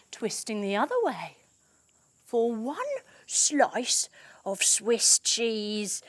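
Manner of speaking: ordinary delivery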